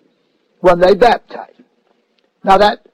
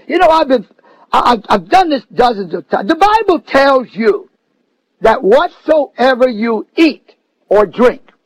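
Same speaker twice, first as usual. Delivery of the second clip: normal talking voice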